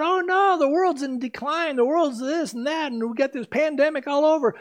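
high, mocking "oh no" of worried people; hammed-up fretful voice of people freaking out; mock panic. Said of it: "a lot of people are freaking out".

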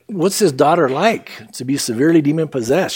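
usual speaking voice

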